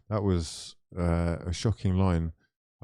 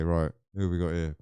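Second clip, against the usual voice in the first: ordinary talk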